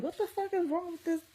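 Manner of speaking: high, soft incredulous aside; baffled reaction. Retold or imitated. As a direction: retell your bewilderment in a high, hushed pitch. Baffled reaction to his anger.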